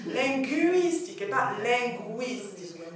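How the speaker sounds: slow, linguistique with the U glide then the plain version; slow contrast. Shown as a demonstration. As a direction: contrast the two sounds slowly. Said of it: "C'est pas le E, c'est le U". (lentement, « linguistique » avec le son u semi-consonne)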